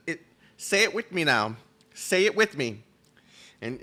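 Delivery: ordinary talk, nothing marked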